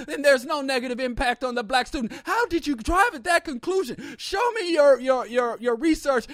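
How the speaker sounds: high, loud mock outrage; furious challenge. Audience member confronting the panelist, high loud mock outrage. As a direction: tear into the panelist like an indignant professor.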